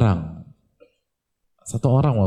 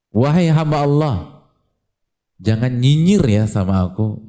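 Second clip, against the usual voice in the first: polite employer; 4 semitones higher, 4 dB louder than usual. Retold or imitated. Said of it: "Gitu bahasanya".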